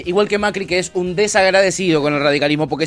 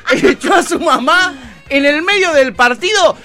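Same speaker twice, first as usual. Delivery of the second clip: lightly hammed-up reporter read, higher pitch; mock sports reporter; 9 semitones higher, 4 dB louder than usual. Host presenting tennis scandal clip, mock reporter voice. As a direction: announce it like a gossip TV host.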